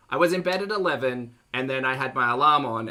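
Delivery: ordinary talk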